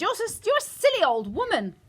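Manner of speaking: high, loud sputtering outrage; affronted mother losing patience; indignant insult. A narrator's reading. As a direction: scold the old woman angrily. Mother insulting grandmother in quarrel.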